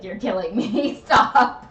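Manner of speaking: laughing through the words; giggling while saying it. Real, not acted. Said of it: "Can you not smile when you say that at me".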